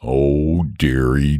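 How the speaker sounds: low, woeful dragon moan. A narrator's reading. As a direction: groan it out in dismay. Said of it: "moaned the dragon".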